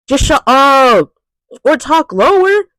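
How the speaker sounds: ordinary talk, nothing marked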